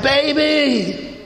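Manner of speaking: high, drawn-out excited call; excited greeting. Retold or imitated. Husband phoning his wife with news, excited greeting.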